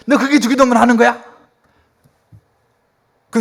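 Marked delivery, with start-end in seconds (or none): 0.1-1.2 loud, high-pitched indignant challenge; imitated angry scolding. Pastor rebuking a relative for rote prayer, angry. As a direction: tell them off as an outraged uncle would. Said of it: "뭐 이렇게 막 화를 내시는 거죠".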